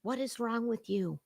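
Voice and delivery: muttered disbelief; quiet disbelief. Real, not acted. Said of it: "repeated louder".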